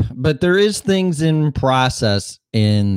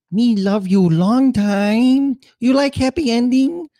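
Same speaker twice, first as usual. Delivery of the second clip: mock broken-English movie quote; 13 semitones higher than usual. Quoting a stereotyped movie line.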